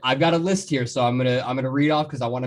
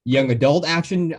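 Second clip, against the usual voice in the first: usual speaking voice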